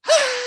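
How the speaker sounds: very high loud shriek; group scream. Retold or imitated. Kids startled by flying garbage, high scream. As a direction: scream in fright.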